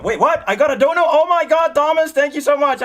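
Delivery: high, loud surprised thanks; surprised delight. Real, not acted. Streamer suddenly spotting Thomas's donation, surprised. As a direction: gasp and thank him.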